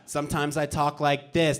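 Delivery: low-toned comic character voice. Shown as a demonstration. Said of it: "Bobby Two Tones".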